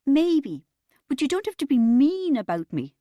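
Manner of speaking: high, loud petulant protest; childish sulk; sulky thirteen-year-old. A narrator's reading. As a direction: whine it like a young teenager. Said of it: "I sounded like a thirteen-year-old".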